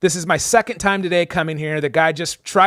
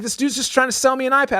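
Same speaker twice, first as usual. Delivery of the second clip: exasperated; 10 semitones higher, faster than usual. Retold or imitated. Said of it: "getting more mad".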